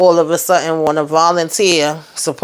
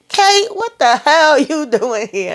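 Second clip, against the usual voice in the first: high, slow startled question; imitating surprise; 11 semitones higher, slower than usual. Tommy finding Kate in the house, slow high startled imitation. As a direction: act out Tommy catching Kate, pitched way up. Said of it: "so he was like".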